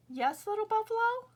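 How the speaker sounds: high, bright little bird voice; lightly voiced meadowlark. Meadowlark answering Little Buffalo, high chirpy bird voice.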